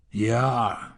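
ordinary delivery

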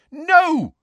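loud, high cry of protest; shout. A narrator's reading. Boy trying to stop the headmaster confessing, shouting. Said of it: "shouted Harry".

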